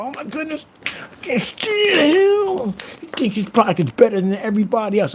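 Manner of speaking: high, squeaky mock complaint with a stretched shout; mocking whiny voice. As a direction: mimic a whiny family member griping. Mocking troll's family complaining.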